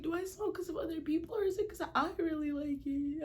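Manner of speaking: high tearful put-on wail, laughing through it; fake crying. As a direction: cry it out as an act. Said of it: "But most of it's an act".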